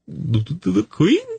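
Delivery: stammering, then a high gasping rise; gasped. A narrator's reading. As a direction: gasp it out in shocked disbelief. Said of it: "Saras gasped".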